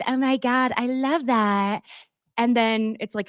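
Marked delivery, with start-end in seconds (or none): 0.1-1.8 Lisa Barlow voice. Shown as a demonstration. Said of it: "get a little higher with your voice".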